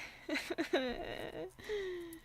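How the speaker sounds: helpless giggle into silence; embarrassed laugh. Real, not acted. Streamer laughing at her own fumbling, embarrassed giggle.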